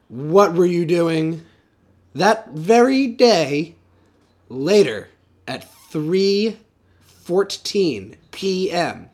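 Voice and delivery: slow, deliberate detective questioning; slow interrogation. Acted. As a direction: drag each word out like an interrogator.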